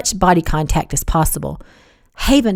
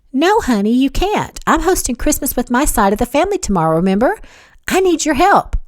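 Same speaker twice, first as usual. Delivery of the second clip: higher-pitched motherly voice; reminding mother; 7 semitones higher than usual. A narrator's reading. Mother telling her daughter she can't go, chiding reminder.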